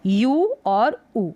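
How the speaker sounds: यू as a long "yoo", ऊ as a long "oo"; drawn-out vowel names. Shown as a demonstration. Teacher contrasting the two sounds, slow यू and ऊ. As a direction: say यू then ऊ slowly, each vowel stretched. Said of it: "यू पढ़ा जा रहा है".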